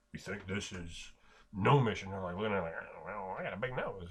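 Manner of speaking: low, softly spoken orc musing; quiet aside. Acted. Orcs privately sizing up a gnome relic, quiet aside. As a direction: size them up under your voice. Acting out the orcs' hushed appraisal.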